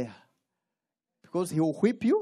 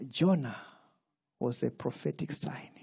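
hushed teaching; 8 semitones lower, 7 dB quieter than usual. Real, not acted. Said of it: "quiet teaching passage".

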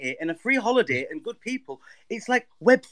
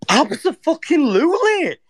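excited; 3 semitones higher, 6 dB louder than usual. Real, not acted. Eager yes to a free trip.

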